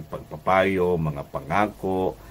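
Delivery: ordinary delivery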